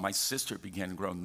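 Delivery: usual speaking voice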